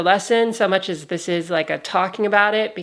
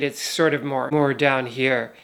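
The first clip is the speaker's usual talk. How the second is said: lower, relaxed natural voice; deep relaxed voice; 6 semitones lower than usual. Shown as a demonstration. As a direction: let the voice drop right down. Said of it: "to a full relaxed state".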